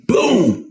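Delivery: sudden high "boom"; imitated impact boom. Man retelling his fall forward, exclaimed crash noise. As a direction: punch out a falling thud as a word.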